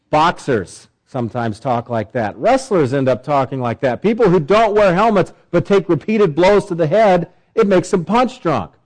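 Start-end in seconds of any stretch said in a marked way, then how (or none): none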